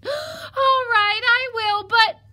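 very high, loud wailing surrender; panicked piglet. Acted. Piglet is ordered into the oven, wailing. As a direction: wail it in squealing panic.

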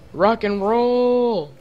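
high, long drawn-out whoop; excited cheer. Real, not acted. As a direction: stretch it out like a rallying cry. Rallying during the game.

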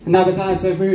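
usual speaking voice

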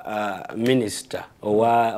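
normal talking voice

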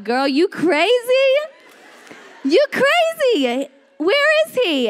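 high, stretched disbelief; incredulous doubters. Acted. Doubters challenging a woman's faith, high incredulous voice. Acting out doubters calling her crazy.

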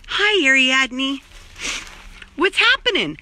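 high, slow coo to the cat; high pet talk; cat greeting. Real, not acted. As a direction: sing her name slowly.

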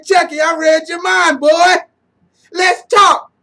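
shouting through laughter, high and loud; laughing. Real, not acted. Fan laughing hard at the coach's timeout, shouting. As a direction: crack up while yelling it. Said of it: "I start laughing".

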